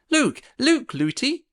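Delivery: put-on high, chattering young girl; little princess voice. A narrator's reading. Princess calls to her running nurse, high and chattering.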